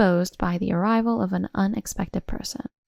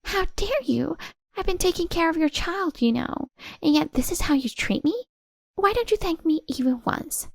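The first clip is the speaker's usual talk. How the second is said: much higher, outraged woman's voice; indignant Tracy; 9 semitones higher than usual. Acted. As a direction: hurl it back in outrage.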